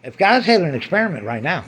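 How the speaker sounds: ordinary talk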